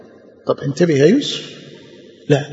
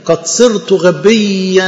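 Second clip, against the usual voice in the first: slow verse; much slower than usual. Shown as a demonstration.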